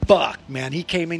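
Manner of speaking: ordinary talk, nothing marked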